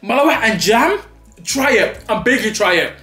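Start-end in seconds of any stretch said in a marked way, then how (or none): none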